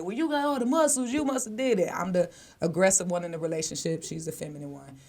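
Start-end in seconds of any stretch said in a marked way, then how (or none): 0.0-1.9 higher-pitched voice of judging bystanders; a voiced crowd of accusers, pitched up; mock accusing onlookers. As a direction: imitate people blaming you. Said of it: "they gonna be looking at me like".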